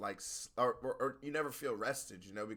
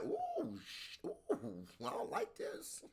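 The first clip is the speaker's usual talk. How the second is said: high, strained oohs fading to a whisper; mock pain; 4 dB quieter, much slower than usual. Imagining a beating teaching you a lesson, mock pained. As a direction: act hurt, voice dropping away to a breathy whisper. Voicing someone learning from pain.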